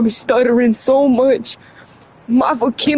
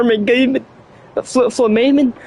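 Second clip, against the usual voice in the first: ordinary talk, nothing marked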